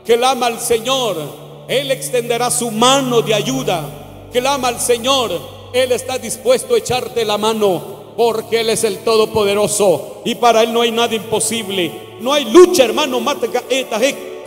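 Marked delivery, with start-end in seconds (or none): none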